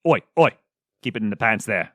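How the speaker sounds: put-on jokey British-accented telling-off. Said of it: "I don't know why I did an accent for her".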